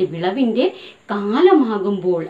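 വിളവിന്റെ കാലമാകുമ്പോൾ read slowly, stress on വിളവിന്റെ; slow emphasis for the class. Shown as a demonstration. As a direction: spell out വിളവിന്റെ carefully for pupils. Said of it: "underline ചെയ്തോളൂ".

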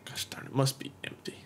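whispered under the breath. Real, not acted. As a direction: mutter it as a whisper. Muttered whisper in game.

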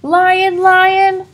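high, loud urgent call; dramatic alarm. Acted. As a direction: warn everyone dramatically. Lion announced in story.

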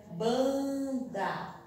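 "banda" with the nasal ban held long; slow dictation. Shown as a demonstration. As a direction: draw out ban, then place da in "banda". Teacher dictates word while writing. ("banda" ditado devagar, ban alongado)

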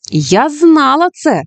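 slow triumphant exclamation, pitched high; exclaiming. Acted. Judy exclaims to Stink that she knew it, triumphant. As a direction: crow it out as if proven right. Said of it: "вигукнула Джуді".

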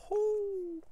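high falsetto whoo of excitement; a quick delighted whoop; excited whoop. Real, not acted. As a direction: let out a shrill cheering whoo. Cheering at the story twist.

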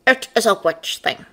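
intoning bird demon. A narrator's reading. Bird demon explains to the man, intoning. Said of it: "Kaiser intoned".

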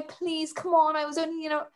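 mock pleading. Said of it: "So I start pleading".

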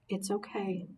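soft whisper in the ear. Acted. Mom comforting her crying son, soft whisper. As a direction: hush it close and soft. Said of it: "she whispered in my ear".

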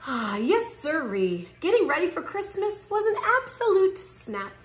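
ordinary delivery, nothing marked

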